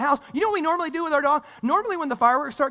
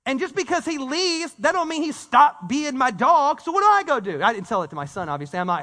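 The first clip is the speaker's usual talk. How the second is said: ordinary delivery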